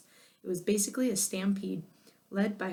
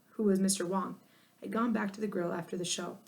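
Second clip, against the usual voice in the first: ordinary delivery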